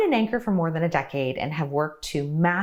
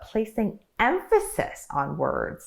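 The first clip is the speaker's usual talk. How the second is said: "emphasis" lifted high and drawn out; slow down and hit "emphasis" with raised pitch; emphasized EMPHASIS; 5 semitones higher, slower than usual. Real, not acted. Coach models the tip while explaining it, stressing "emphasis". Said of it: "see, I even emphasize the emphasis".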